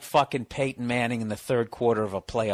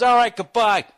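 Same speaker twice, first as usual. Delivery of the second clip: loud, high curt goodbye; mad dad; 11 semitones higher, 9 dB louder than usual. Retold or imitated. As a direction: imitate a fed-up father ending the call. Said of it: "he started getting mad".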